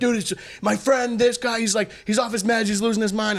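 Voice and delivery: high-pitched, urgent excuse-making; imitated apologetic pleading. Friend explaining the intruder is off his meds, imitated pleading.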